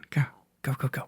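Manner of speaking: plain reading voice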